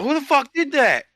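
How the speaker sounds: high, slow furious shout; retold angry yell. Player shouting at the field after being shot, angry high yell. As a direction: demand who fired, yelling. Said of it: "screaming at him".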